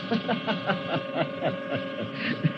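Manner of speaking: quiet gloating laugh; soft evil chuckle. Acted. Villain chuckling at the girl's pleas, softly gloating. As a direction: chuckle with menace.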